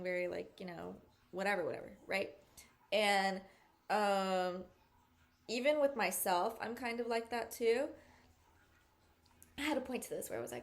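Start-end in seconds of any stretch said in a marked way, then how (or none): none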